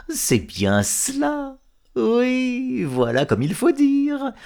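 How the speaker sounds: high, slow mocking praise; mocking approval. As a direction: sneer as if giving approval. (approbation moqueuse, aiguë et lente)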